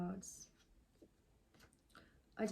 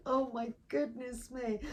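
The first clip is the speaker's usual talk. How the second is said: real laughter breaking through, louder and higher; laughing; 6 semitones higher, 13 dB louder than usual. Real, not acted. Reader laughing at fumbling the card text. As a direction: laugh helplessly at yourself. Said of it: "I can't even read the card".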